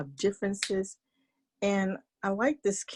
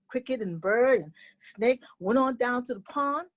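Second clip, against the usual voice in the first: usual speaking voice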